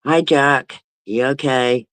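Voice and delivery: normal talking voice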